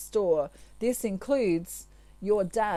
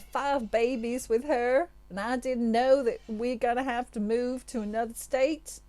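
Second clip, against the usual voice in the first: higher, louder, hammed-up American accent as a wailing talk-show guest; mock American accent; 5 semitones higher, 4 dB louder than usual. Jilted guest yelling at Cletus on Jerry Springer, hammed-up American accent. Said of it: "forgive my American accent".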